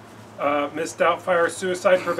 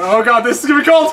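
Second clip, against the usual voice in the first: loud, fast laughing yell; laughing shout; 2 semitones higher, 8 dB louder, faster than usual. Real, not acted. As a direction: shout through laughter at the cold. About to get ice water.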